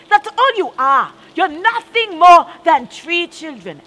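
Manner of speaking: put-on mother shouting at the children over the broken plate, furious.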